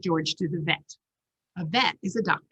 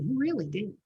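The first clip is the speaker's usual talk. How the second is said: ordinary delivery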